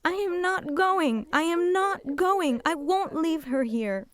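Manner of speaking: high, loud, drawn-out protest; screaming refusal. Retold or imitated. Daughter refusing to leave her mother, screamed protest. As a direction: cry out in desperate refusal, stretching the words. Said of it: "I was screaming".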